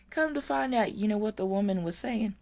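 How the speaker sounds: ordinary talk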